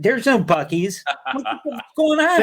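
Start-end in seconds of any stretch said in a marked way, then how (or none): 1.3-2.4 high, incredulous exclamation pitched way up; baffled outburst. Retold or imitated. Telling podcast hosts about the missing Buc-ee's, incredulous. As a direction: act out the disbelief, voice jumping high. Retelling confusion at missing store.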